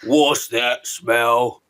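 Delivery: slow, drawn-out giant voice; BFG giant voice. Retold or imitated. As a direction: stretch it out like a friendly giant. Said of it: "Just get him to do".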